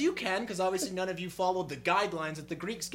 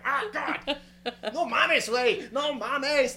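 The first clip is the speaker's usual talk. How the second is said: Mexican Spanish exclamations; 4 semitones higher, 4 dB louder, much slower than usual. Retold or imitated. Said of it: "sitting there fucking".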